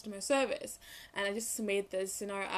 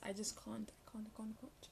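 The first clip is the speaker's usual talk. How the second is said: much softer, breathy whisper of disbelief; 13 dB quieter than usual. Real, not acted. Vlogger telling viewers she can't reuse masks, whispering. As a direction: whisper it, repeating in exasperation.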